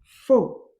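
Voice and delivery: Fo called out loud and clear; teacher modelling Fo; loud model syllable. Shown as a demonstration. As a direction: sound out Fo loudly. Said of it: "la syllabe fo".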